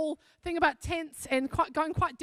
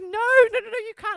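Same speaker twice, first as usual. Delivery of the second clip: retold shocked refusal, higher pitch; shocked protest; 5 semitones higher, 6 dB louder than usual. Woman refusing a stranger's payment, shocked protest. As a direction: jump up in pitch to refuse a gift, imitating someone stunned.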